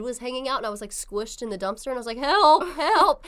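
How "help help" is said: loud, high comic yelps; mock cry for help. Squished in the dumpster, mock calling for help. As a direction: call out helplessly, hammed-up.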